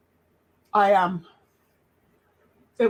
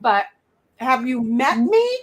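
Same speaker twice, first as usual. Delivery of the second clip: talking with food in mouth; mouth full. Real, not acted. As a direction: speak through a mouthful. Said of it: "should not have had my mouth full of food".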